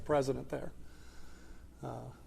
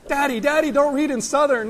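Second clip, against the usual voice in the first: put-on much higher and louder, a whiny kid begging Daddy; pleading little boy; 11 semitones higher, 12 dB louder than usual. Son begging his dad to stop reading in Southern, high whiny plea. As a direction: squeal it like a son desperate to stop the story. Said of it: "He put his hands over my mouth".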